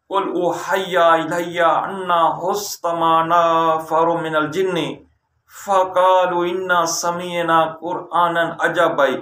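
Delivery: slow, measured Arabic recitation; chanted Quran verse; Quranic recitation. Shown as a demonstration. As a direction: intone the verse slowly, like a prayer. Recites verse second time.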